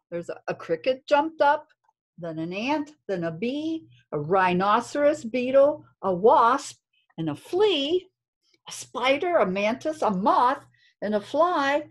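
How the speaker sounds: put-on lilting read-aloud for kids; reading to children. A narrator's reading. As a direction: storytime rhythm, gentle and sing-song. Reads story to class.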